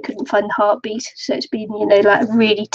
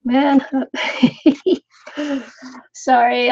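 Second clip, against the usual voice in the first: ordinary talk, nothing marked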